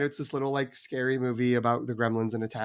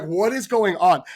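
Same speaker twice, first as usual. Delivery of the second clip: bewildered question; 6 semitones higher, 6 dB louder than usual. Retold or imitated. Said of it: "And it's just like".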